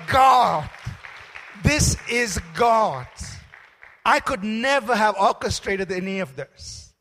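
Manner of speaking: loud, amazed cry to God; joyful exclamation. Retold or imitated. Student hearing his fees are paid, crying out loud to God. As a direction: shout out the wonder of it. Overjoyed at the answered prayer.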